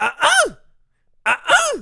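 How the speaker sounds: loud, high two-part tennis grunt; high-pitched grunt, punched on the second beat; double grunt. Retold or imitated. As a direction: imitate a straining player's "uh-UH". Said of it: "'Cause she got to, like, a".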